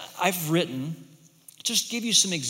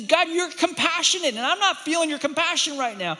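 pleading voice raised high; mock lament prayer; 10 semitones higher, 4 dB louder than usual. Preacher voicing a lamenting worshipper, pleading and high. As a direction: cry out to God, lightly acted. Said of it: "To this".

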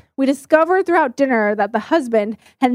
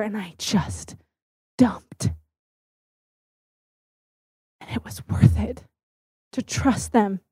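hushed; 3 semitones lower, 6 dB quieter than usual. Real, not acted. Tender end of her crying story.

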